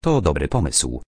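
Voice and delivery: plain reading voice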